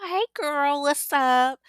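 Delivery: put-on high, loud happy hey girl; light up at seeing your friend; excited greeting. Daphne greeting her visitors, loud and excited. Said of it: "she's like".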